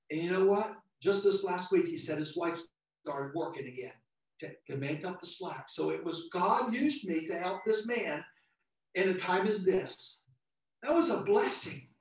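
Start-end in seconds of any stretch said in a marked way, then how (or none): none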